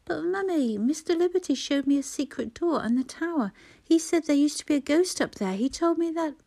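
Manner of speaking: put-on high, eager little boy; a small boy bursting with news; excited child voice. A narrator's reading. Son interrupting his mother, eager child voice.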